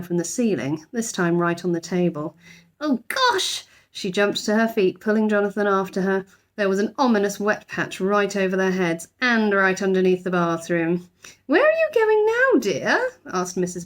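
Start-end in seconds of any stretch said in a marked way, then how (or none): 2.8-3.6 high startled exclamation; girl's high alarmed cry; alarmed gasp. A narrator's reading. Judy alarmed by drip. 11.5-13.1 high motherly query; high questioning mother voice; Mrs Brown asking. A narrator's reading.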